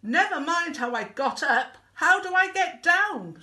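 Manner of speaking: high, loud retort from above; urgent stranded holler; exasperated shouting. Acted. Stranded Wilbur retorts from the tree.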